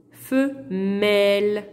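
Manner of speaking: femelles stretched very slowly, each sound clear; teacher slowing femelles down for pupils; drawn-out model word. Shown as a demonstration. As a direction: draw femelles out slowly to show the e. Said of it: "hein, vous voyez".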